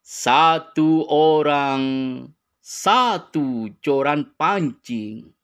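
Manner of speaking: each word slowly spelled out; drawn-out emphatic repetition; slow and deliberate. Shown as a demonstration. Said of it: "Mantap, guys".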